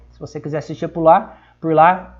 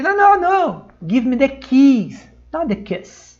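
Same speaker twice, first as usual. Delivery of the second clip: flustered correction; 5 semitones higher, slower than usual. Retold or imitated. Father corrects the kiss mix-up.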